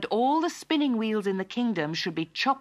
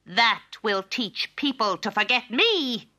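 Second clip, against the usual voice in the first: loud, slow, high wicked cackle; cackling old woman; 5 semitones higher, 4 dB louder, slower than usual. A narrator's reading. Wicked fairy gloats over the princess, cackling. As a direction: crow it slowly in triumph. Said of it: "cackled the old woman".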